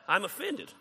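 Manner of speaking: ordinary delivery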